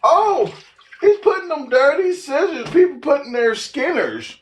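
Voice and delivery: high-pitched mock-outraged commenter; "you people" voice. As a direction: squeal it like an annoying busybody. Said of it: "you people voice".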